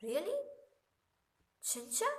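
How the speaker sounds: high, bright rising really? चिंचा?; surprised question. Shown as a demonstration. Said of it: "जिसका मतलब होता है".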